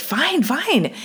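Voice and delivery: high-pitched mock exasperation; re-enacted fed-up surrender; exasperated giving in. Retold giving in to screaming spirit.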